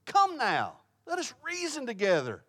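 high begging appeal; pleading. Acted. Preacher voicing God begging his people, pleading. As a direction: plead with them, pitched well up. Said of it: "Can you hear the pleading in his voice there?".

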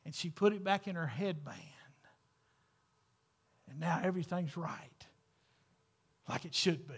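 hushed whisper. Real, not acted. Preacher tells congregation about the coin, whispered. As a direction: drop to a secret whisper.

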